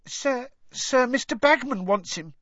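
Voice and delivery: put-on high, hurried nervous boy; anxious kid pleading with teacher. A narrator's reading. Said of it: "he said nervously".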